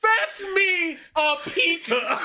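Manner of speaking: high, slow, laughing order to a servant; mock-regal command. Co-host as a customer ordering pita, mock-grand command. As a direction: play a hammy noble demanding food.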